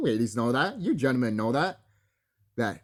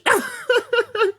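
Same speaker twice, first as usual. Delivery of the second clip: high laugh; 4 dB louder than usual. Real, not acted. Vlogger cracking up at the cobra king, laughing high.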